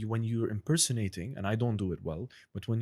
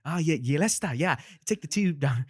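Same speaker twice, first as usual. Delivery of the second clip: loud, higher sing-songy Liverpool accent; 6 semitones higher, 5 dB louder than usual. Shown as a demonstration. Playing a Liverpool local, loud and higher. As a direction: lilt it up like a Scouse local. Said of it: "that's how he would do that impression".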